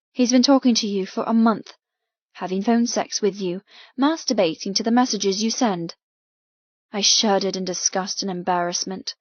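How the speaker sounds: ordinary talk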